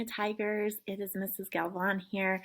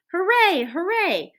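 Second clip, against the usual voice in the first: high, loud double cheer; excited hooray; 11 semitones higher, 8 dB louder, slower than usual. A narrator's reading. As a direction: cheer like a dog spotting a park. Dog sees indoor dog park.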